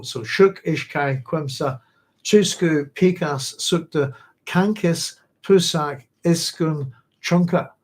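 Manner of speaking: "Shuk, Ishkai, Quimsa, Chusku, Pikas, Sukta, Kankis, Pusak, Iskun, Chunka" with each number stressed and spoken distinctly; carefully pronounced Quechua numbers one to ten; counting in Quechua. Shown as a demonstration. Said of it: "one to ten in that language".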